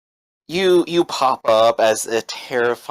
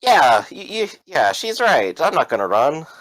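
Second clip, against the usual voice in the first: high, stammering, eager agreement; nervous captive; 5 semitones higher, 3 dB louder than usual. Acted. George assures Sarah he will stay, nervously eager. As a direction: agree hastily in a raised, tripping voice.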